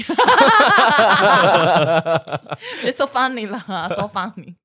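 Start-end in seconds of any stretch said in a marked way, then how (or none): 0.2-2.8 a long rising loud laugh. Real, not acted. Guest laughing hard at her own story, loud rising laughter. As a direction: let the laugh roll on.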